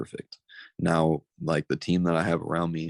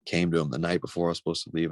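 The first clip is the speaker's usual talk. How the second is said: normal talking voice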